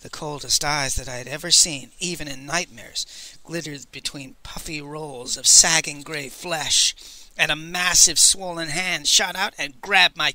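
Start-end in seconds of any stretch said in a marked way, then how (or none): none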